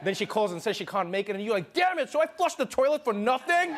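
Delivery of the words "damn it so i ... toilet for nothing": higher-pitched exasperated yelp; comic frustrated outburst; mock outrage. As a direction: vent like a stood-up guy, hammed-up. Acted frustrated guy, date cancelled.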